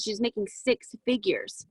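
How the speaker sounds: ordinary talk, nothing marked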